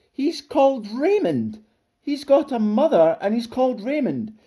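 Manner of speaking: high, loud incredulous exclaiming; astonished. A narrator's reading. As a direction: cry out in utter disbelief. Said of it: "Everyone was astonished".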